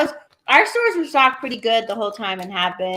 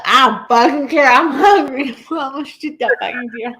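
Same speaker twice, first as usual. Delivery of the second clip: louder devil-may-care outburst; mock defiance; 3 semitones higher, 4 dB louder than usual. Hunter shrugging off the license rule, mock defiance. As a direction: brush it off loudly, half laughing. Imagined defiant reply, acted.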